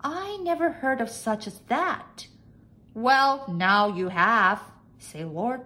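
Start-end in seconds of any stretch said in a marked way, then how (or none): 0.0-2.3 high-pitched, doubting maid; high maid's disbelief; doubtful maid. A narrator's reading. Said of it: "asked Nellie Mae doubtfully". 2.9-4.6 loud, slowing lordly retort; emphatic master's voice; pompous master. A narrator's reading. Master dismisses her doubt.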